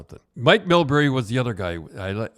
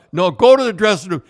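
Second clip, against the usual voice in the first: louder, higher, insistent; firm repeated order; 7 semitones higher, 10 dB louder than usual. Retold or imitated. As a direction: repeat the order without budging. Coach repeats order firmly.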